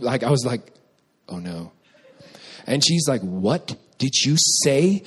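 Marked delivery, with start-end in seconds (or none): 1.3-1.7 low, hushed little realization; quiet dread. Retold or imitated. Dreading his slip of the tongue. 3.2-5.0 slow, spaced-out mock-mom challenge; stern disbelief. Mom confronts son over insult.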